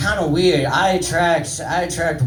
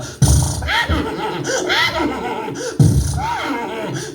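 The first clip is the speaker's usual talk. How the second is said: high horse whinny with lip-flutter; horse noise. Shown as a demonstration. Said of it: "you can make horse noises".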